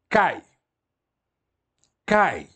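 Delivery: Cai said like "kai", clear and firm; Cai, the Greek "and", said slowly; model pronunciation. Shown as a demonstration. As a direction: say Cai for the class to repeat. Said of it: "Conjunção aditiva em grego".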